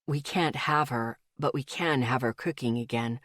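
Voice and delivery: hushed and moved; soft low male voice. A narrator's reading. Male friend, emotional and soft.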